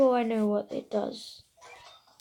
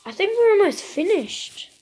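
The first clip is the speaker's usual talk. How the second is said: excited; 8 semitones higher, 9 dB louder than usual. Real, not acted. Excited near the end.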